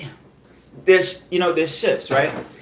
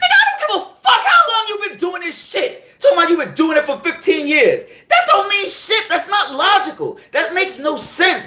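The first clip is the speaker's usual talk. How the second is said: getting worked up; 7 semitones higher than usual. Real, not acted. Ranting about the guy's fifteen years claim, real heated shout. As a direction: let the anger boil over at the stupid excuse. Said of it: "you see I'm getting worked up".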